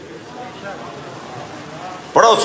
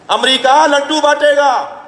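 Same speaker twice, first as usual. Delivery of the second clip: sarcastic jab; 8 semitones higher, slower than usual. Real, not acted. Mocking enemies celebrating sectarian strife.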